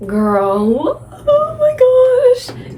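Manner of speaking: high, drawn-out oh my gosh; overwhelmed gush. Real, not acted. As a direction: melt over how good it was. Gushing about finished book.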